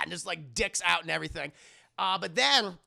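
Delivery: usual speaking voice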